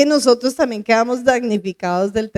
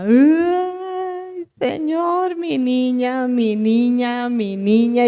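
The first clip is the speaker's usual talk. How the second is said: tearful lament; much slower than usual. Retold or imitated. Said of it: "yo lloraba todos los días". (madre que llora suavemente por su niña)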